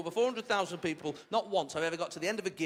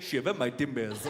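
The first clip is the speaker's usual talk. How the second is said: low-pitched mock Somali pirate; Somali pirate accent; 7 semitones lower than usual. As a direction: imitate a modern-day pirate in a Somali accent. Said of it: "that's how pirates sound now".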